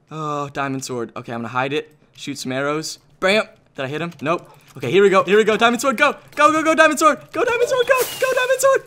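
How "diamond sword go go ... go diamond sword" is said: rising to a very high squeal; frantic battle cry. Real, not acted. Player attacking with his diamond sword, frantic. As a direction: urge yourself on, climbing higher and higher. Charging into final fight.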